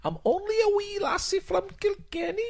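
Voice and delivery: very high falsetto lassie voice; put-on squeaky catchphrase voice; wee Irish lassie. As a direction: play a tiny Irish girl, pitched right up. Repeats the catchphrase in character.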